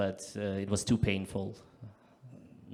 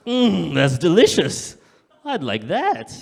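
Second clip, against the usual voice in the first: savouring diner; 6 semitones higher, 8 dB louder, slower than usual. Retold or imitated. Diner ordering in the restaurant bit.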